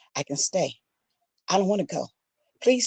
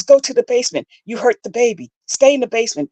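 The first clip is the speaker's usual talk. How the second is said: loud, higher furious orders; he yells; 7 semitones higher, 7 dB louder than usual. Retold or imitated. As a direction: send her to the basement in a rage. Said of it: "He yells".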